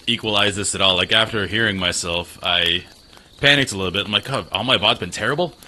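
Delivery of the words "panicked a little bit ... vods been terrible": ordinary delivery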